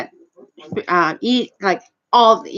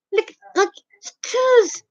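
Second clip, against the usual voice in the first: indignant; 8 semitones higher, slower than usual. Retold or imitated. Indignant at rude man.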